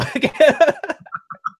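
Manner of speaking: high-pitched burst of laughter. Real, not acted. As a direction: laugh high after the joke. Laughing after acted line.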